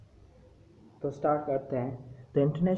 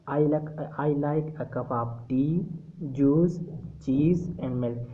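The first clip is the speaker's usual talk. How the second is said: slow, each item lifted in pitch; list intonation; much slower than usual. Shown as a demonstration. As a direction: rise on tea, juice, cheese. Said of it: "हर चीज़ पर ... pitch को ज़्यादा कर देते हैं". (हर चीज़ पर उठती आवाज़, धीमी सूची)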